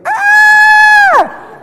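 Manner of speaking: high loud delighted squeal; imitated baby squeal. Father imitating his daughter squealing over an egg. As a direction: squeal like a toddler with a toy.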